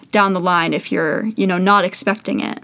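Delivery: ordinary talk, nothing marked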